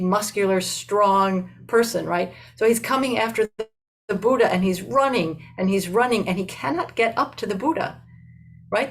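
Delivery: ordinary talk, nothing marked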